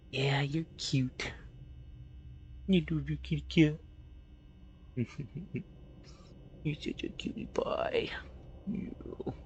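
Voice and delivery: high whispered cooing at a cat. Real, not acted. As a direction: coo softly like to a pet. Baby talk to the cat.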